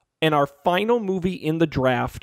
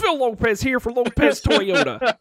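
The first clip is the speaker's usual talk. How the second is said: hammed-up car dealer ad voice; used car salesman; 7 semitones higher than usual. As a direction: sell the lot like a TV car ad. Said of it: "is he a used car salesman?".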